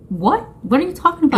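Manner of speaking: incredulous, pitch climbing; confused disbelief. Retold or imitated. Replying to her niece, mildly confused. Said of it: "I was confused".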